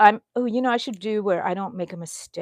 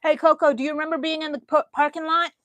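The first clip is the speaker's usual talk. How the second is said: talking to dog; 7 semitones higher, faster than usual. Real, not acted. Asking her dog Coco about the parking lot, pet voice. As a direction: coax the dog sweetly.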